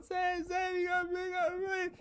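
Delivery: very high crying shout; imitating crying woman. Retelling the voicemail, high mock-crying shout. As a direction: wail it like a sobbing young woman. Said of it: "She's crying. She's going".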